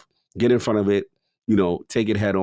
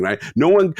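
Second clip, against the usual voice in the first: normal talking voice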